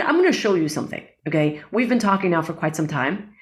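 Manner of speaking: ordinary talk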